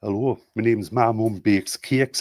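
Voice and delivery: slow Geordie with a rising end; put-on Newcastle Geordie accent. Shown as a demonstration. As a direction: introduce yourself in broad Geordie, drawing out the name. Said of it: "what about a Geordie one?".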